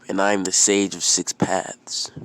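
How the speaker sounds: loud, proud sage announcement; acted proclamation, statement tune; grand self-introduction. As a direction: declare your title firmly. Old sage introduces himself.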